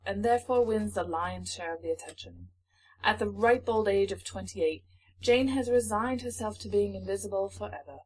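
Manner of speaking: put-on British accent, softening at phrase ends. Shown as a demonstration. Co-host resuming book blurb after encouragement, British accent. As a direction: carry on the blurb in British English. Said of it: "read this in a British accent".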